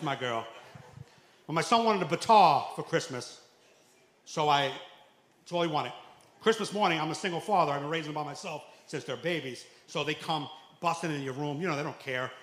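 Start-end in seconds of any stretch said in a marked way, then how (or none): none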